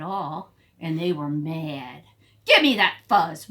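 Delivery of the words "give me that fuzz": angry demand. Acted. Prairie dogs fighting over fuzz.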